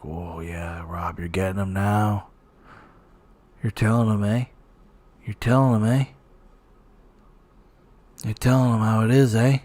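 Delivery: hushed mock cheering on the host; imitating stoked fanboy. Mock fanboy to host, hushed and egging on. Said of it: "Look how fucking stoked he is".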